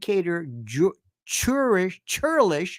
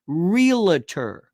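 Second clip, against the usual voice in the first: usual speaking voice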